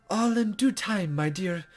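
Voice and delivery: a hint of a coy, teasing sage; lightly voiced mysterious guide.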